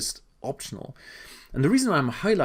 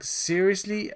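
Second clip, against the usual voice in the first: incredulous; 7 semitones higher, slower than usual. Real, not acted. Mocking the overpriced product.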